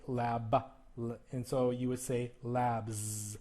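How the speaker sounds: "labs" slow, ending in a buzzed z; "Lab" then "labs" with a buzzing z; exaggerated voiced ending. Shown as a demonstration. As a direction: stretch "labs" to show the z. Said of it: "I'm emphasizing these and exaggerating the sound".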